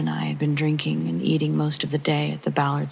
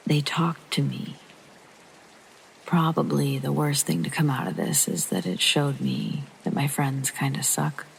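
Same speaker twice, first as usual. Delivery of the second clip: plain reading voice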